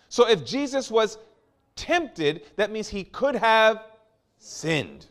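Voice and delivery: peaking sermon delivery; rising preaching climax. Acted. Preaching climax, high and loud.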